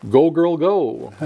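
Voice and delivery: slow, high-pitched cheering call; lightly acted, stretched-out rooting; mock cheer. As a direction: draw it out like egging someone on. Likens the mother's call to cheering.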